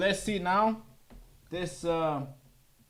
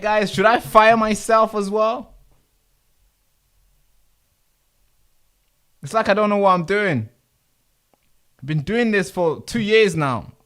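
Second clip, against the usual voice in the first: loud, high, self-mocking complaint; exasperated rant; 6 semitones higher, 8 dB louder than usual. Real, not acted. Streamer complaining loudly to chat about his clumsiness. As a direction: rant at yourself, half laughing at the mess. Streamer baffled by broken setup.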